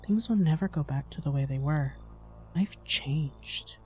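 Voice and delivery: hushed, ominous character voice with long pauses; brooding game character. Acted. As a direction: voice a grim survivor, quiet and deliberate. Reads game character's lines.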